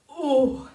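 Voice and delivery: loud effortful oh; giant's effort groan; heavy mock groan. Shown as a demonstration. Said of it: "big stompy boot".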